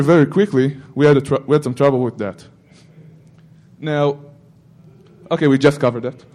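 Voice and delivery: usual speaking voice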